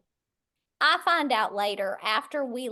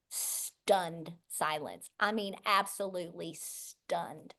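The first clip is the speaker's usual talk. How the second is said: ordinary delivery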